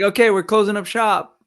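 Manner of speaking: a hammed-up shop closing up for the day; mock announcement. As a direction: play the staff shutting it down. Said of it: "the whole studio was just like".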